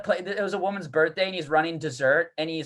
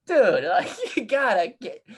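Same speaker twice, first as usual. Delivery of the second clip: ordinary delivery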